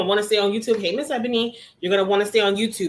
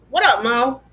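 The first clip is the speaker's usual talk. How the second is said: excited greeting; 3 semitones higher, 6 dB louder than usual. Real, not acted. Greeting friend joining live class.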